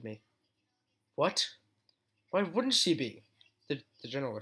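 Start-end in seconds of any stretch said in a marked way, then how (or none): 1.1-3.2 higher, thrown off guard; startled disbelief. Acted. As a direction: caught completely off guard. Blindsided by question.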